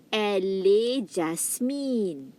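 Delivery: "Elle est Jasmine." slow, falling on est; slow French read. Shown as a demonstration. Teacher reading the second sample slowly. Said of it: "Now you have here".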